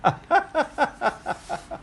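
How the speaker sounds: laughing. Real, not acted. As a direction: laugh while singing la la la. Laughing with toddler.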